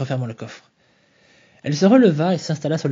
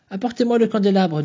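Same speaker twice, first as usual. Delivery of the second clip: appel; 7 semitones higher, 5 dB louder than usual. Acted. Maud lit la lumière, s'agenouillant.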